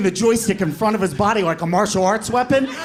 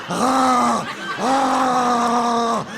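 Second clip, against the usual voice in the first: choking noises; 6 semitones higher than usual. Retold or imitated. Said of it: "making noises like Luca Brasi".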